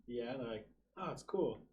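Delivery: normal talking voice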